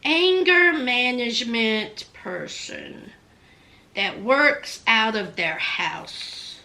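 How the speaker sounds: slow, low muttered search; mock googling. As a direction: read the search out slowly. Voicing producers googling.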